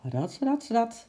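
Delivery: slightly high, slow "rats, rats"; scrambling sound. A narrator's reading. Narrator voices the climb.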